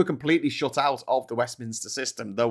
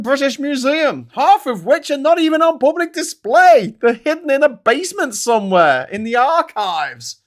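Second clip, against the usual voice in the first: much higher and louder furious rant; angry rant; 10 semitones higher, 7 dB louder than usual. Real, not acted. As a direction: vent your outrage at the stolen treasures. Ranting about stolen museum treasures.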